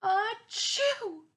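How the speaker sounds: big high loud acted "achoo"; loud sneeze. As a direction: sneeze it out fully. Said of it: "Amos awoke with a sneeze".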